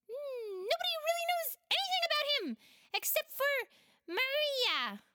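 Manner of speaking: a squeaky high creature sharing gossip; Big Gauro's voice. Acted. Big Gauro tells friends what little he knows, in a squeaky high voice.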